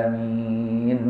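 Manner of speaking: آمين very slow and soft, the long vowel stretched; Warsh-style آمين, long and sung softly; drawn-out amen. Shown as a demonstration. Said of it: "مد بدل".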